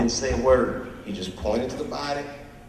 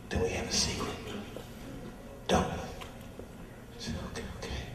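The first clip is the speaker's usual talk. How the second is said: hushed secret; 8 semitones lower, 11 dB quieter, much faster than usual. Acted.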